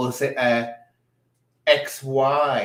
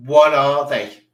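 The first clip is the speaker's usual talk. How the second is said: "What" thrown out much louder, falling hard; loud disbelief; 3 semitones higher, 11 dB louder than usual. Real, not acted. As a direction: shout the question at the screen. Repeats the shocked question louder.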